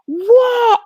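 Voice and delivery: very high, loud what; shrill squeak of surprise; astonished. Acted. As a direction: squeal it in disbelief. Mock astonishment at aha.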